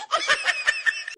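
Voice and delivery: high, mock villain cackle; a hammed-up wicked laugh; scheming cackle. A mother-in-law gloating over her daughter-in-law, a high mock cackle.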